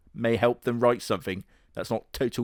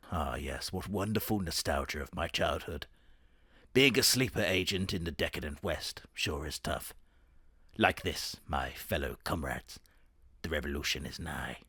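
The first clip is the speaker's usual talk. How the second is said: much lower, much softer conspiratorial voice; sinister propaganda agent; 7 semitones lower, 9 dB quieter than usual. Pretend. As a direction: murmur it like a secret agent hailing comrades. Mock communist sleeper agent monologue.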